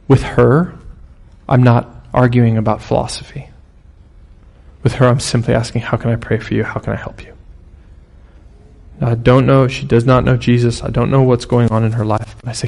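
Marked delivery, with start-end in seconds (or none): 5.8-7.3 gentle offer. Retold or imitated. Gently offering prayer to woman.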